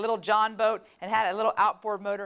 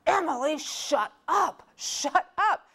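higher, urgent sibling hush-up; big brother snapping; 7 semitones higher, slower than usual. Retold or imitated. Brother silencing his sister, high and urgent. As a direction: shut your sister up fast. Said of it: "And Philip goes".

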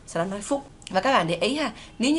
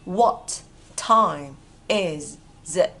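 slow, separated words with pauses; 3 semitones lower, 3 dB louder, much slower than usual. Shown as a demonstration. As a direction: spell it out slowly for learners. Teacher models words one by one.

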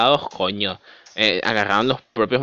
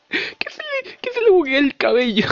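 laughing disbelief; 13 semitones higher than usual. Real, not acted. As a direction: squeal it out amused. Laughing at hair glitch. (riéndose, agudo y lento)

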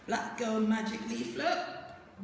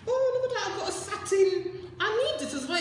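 excited squeal; 9 semitones higher, 5 dB louder, faster than usual. Real, not acted. Finds a satin sleep cap.

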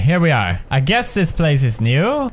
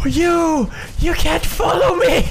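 very high, strained yelling; panicked yell; 13 semitones higher, slower than usual. Real, not acted. Gamer to an enemy following him, panicked yell. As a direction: yell frantically at a pursuer.

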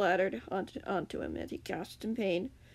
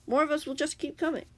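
higher, mildly snappish retort; snapped; 8 semitones higher, 3 dB louder than usual. A narrator's reading. Captive Grian warns more will come, snapping. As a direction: keep snapping back, pitch raised. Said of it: "the Grian snapped".

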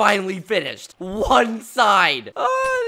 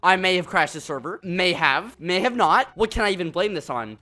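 usual speaking voice

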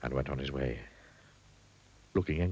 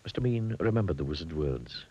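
ordinary delivery, nothing marked

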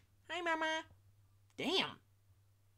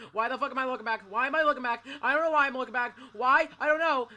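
panicked yelling; 7 dB louder than usual. Real, not acted. Panicked yelling while fleeing in game.

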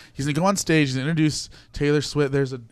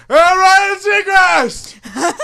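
star-struck yell; 11 dB louder, much slower than usual. Retold or imitated. Blurting out to celebrity.

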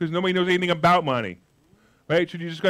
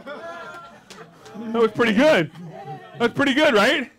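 laughing; 6 semitones higher, much slower than usual. Real, not acted. Laughing at audience answer.

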